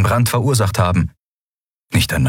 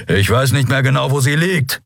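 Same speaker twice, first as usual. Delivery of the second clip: a touch of a growl, raised pitch; lightly voiced growling grump; 7 semitones higher than usual. A narrator's reading. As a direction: growl back irritably. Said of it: "knurrte er".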